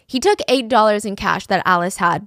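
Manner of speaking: usual speaking voice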